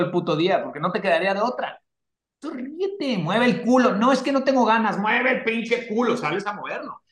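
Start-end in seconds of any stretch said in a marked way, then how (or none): 2.4-3.9 high, barked order to get moving; bossy coach pushing a lazy self; drill sergeant self-talk. Retold or imitated. Pushing himself like a tough coach, barking orders up high. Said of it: "yo me hablo así". 3.9-5.0 whiny excuse. Retold or imitated. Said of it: "acted reluctant reply". 5.0-7.0 drawn-out bossy order, a touch higher; slow mock drill sergeant. Telling his lazy self to move, slow bossy command. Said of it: "yo me hablo así".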